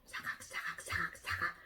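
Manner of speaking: unvoiced, hushed crunching sound words; very soft leaf-gnawing imitation; whispered munching. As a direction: whisper the nibbling rhythmically. Imitates caterpillar munching leaves.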